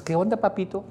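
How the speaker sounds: lightly voiced coy girlfriend, soft; mock coy girlfriend. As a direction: play the girlfriend teasing papito. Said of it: "y tú te dices". (novia coqueta, voz suave e imitada)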